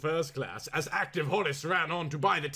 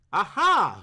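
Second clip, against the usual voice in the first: triumphant aha; 5 semitones higher, 8 dB louder than usual. A narrator's reading. Discovery exclamation.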